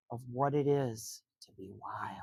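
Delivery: breathy dramatic whisper to close the blurb; hushed whisper. A narrator's reading. Grandma ending the book blurb for the children, in a whisper. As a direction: drop to a whisper, letting the last words hang.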